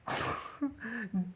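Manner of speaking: quiet breathy laugh; a short soft chuckle. Real, not acted. Laugh alone on line.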